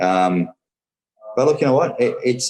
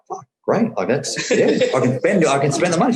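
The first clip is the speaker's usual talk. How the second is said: high-pitched, giddy excitement with a laugh; excited younger self, laughing at it; retold delight; 8 semitones higher than usual. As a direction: act out being thrilled, voice jumping up. Retold delight at getting credit card.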